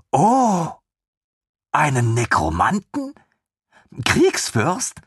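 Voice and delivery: high, eager, incredulous; lieutenant amazed at the news; astonished lieutenant. Acted. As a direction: gasp it out in wonder. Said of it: "lieutenant astonished".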